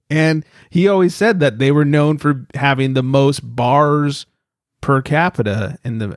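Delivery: ordinary talk, nothing marked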